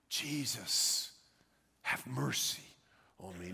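soft, low plea for mercy; understated imitation of a cry; retold yell, hushed. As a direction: voice the cry quietly, at a distance from shouting. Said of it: "he begins to yell out".

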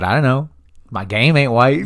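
cocky retort. Retold or imitated. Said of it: "He said".

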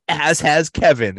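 high, loud, eager add-on; Kevin voice. Retold or imitated. Kevin chimes in to the office, high and eager. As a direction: chime in happily. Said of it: "And Kevin says".

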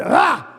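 a sudden high fed-up "Ah!"; mock disgusted groan. Preacher mocking a grumpy riser, fed-up groan. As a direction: groan like you hate the morning.

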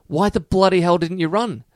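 high-pitched, indignant demand; exasperated captain. Acted. Captain confronting the horse after the loss, exasperated. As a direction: demand an answer from the culprit. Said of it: "the captain comes out and says".

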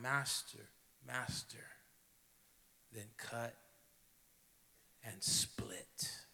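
quiet surrender. A narrator's reading. Bamboo's final surrender.

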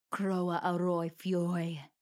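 slow, booming "Croa Aroi Fioy," like a curse; a hint of an angry elf roaring a curse. A narrator's reading. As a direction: roar it out word by word. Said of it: "roared the elf".

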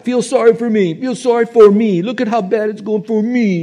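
high, loud whining victim voice; mock the self-pitying complainer, wailing; pitiful woe-is-me wail; whiny self-pity.

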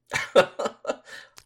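breathy high chuckle; corny dad laugh. Real, not acted. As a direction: laugh at your own joke. Laughing at own joke.

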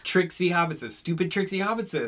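hammed-up creature grievance; Smeagol impression. Imitating Smeagol from the film.

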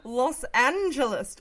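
gasp, then stretch out Los Angeles; awed gasp. Acted. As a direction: say the city slowly in mock awe. Gasped, drawn-out repeat of city.

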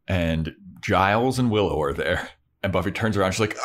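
ordinary talk, nothing marked

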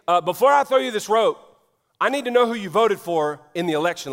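loud, high-pitched mock interrogation; impassioned preaching. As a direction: preach it hard, firing off absurd qualifying questions. Preaches mock qualifying questions.

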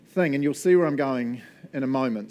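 normal talking voice

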